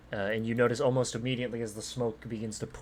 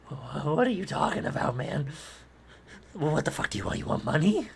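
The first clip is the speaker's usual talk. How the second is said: higher, louder panicky bluster; blustering halfling; 6 semitones higher, 5 dB louder than usual. Acted. Halfling boss scared and defensive toward Jack, high panicky bluster. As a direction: babble scared demands at the intruder.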